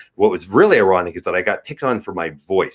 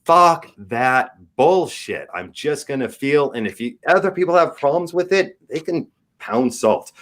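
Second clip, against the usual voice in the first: defiant; 7 semitones higher than usual. Retold or imitated. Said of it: "just said".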